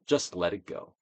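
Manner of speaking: ordinary talk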